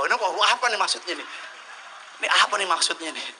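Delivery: loud laughing question. Real, not acted. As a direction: laugh at your own slip. Laughing at own slip. (tertawa sambil bertanya, suara keras)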